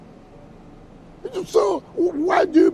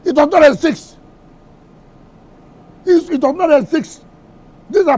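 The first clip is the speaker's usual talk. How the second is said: louder, higher, forceful denial; indignant raised voice insisting it does not exist; angry outburst; 5 semitones higher, 6 dB louder than usual. Real, not acted. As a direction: shut the question down hard. Heated denial on live TV.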